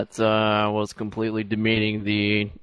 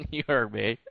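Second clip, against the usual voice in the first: mock tough guy; 9 semitones higher, 8 dB louder than usual.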